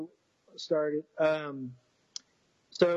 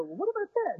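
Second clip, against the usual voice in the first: high squeaky mock question, much higher; goofy question. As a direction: ask it like a goofball, pitched way up. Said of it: "Like a goofball".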